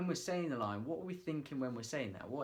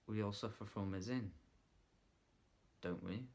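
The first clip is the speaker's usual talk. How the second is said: leaning in; 5 dB quieter than usual. Shown as a demonstration. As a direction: draw them in quietly. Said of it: "just a lean in".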